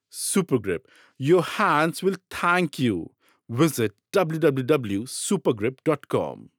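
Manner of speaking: ordinary delivery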